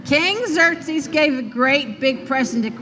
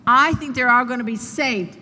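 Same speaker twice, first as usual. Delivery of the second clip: ordinary talk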